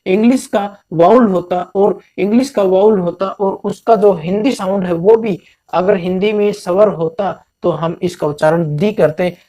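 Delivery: normal talking voice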